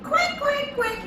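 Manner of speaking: high mother duck quack; playful duck call. Pretend. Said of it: "Mother duck said".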